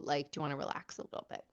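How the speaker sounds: whispered, quick and gentle; soft whisper. Retold or imitated. Husband softly asking wife if she wants to relax, whispered. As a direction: hush it like a tender offer.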